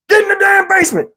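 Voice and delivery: high, fast screamed order; urgent high-pitched command; retold scream. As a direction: mimic a man screaming everyone downstairs. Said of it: "When he screamed".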